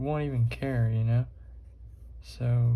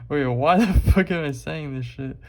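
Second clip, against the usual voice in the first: louder, laughing at himself mid-question; 4 semitones higher, 5 dB louder than usual. Real, not acted. Vlogger laughing at his own rant, loud and amused.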